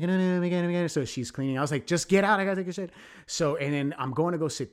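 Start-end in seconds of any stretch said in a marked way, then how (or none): 0.0-0.9 high, loud mock panic; hammed-up frantic repetition; panicky woman. Voicing woman panicking over mess. 1.9-2.9 high, loud, acted-out urgency; mock-exasperated raised voice; exasperated plea. Retelling his urgent plea to her.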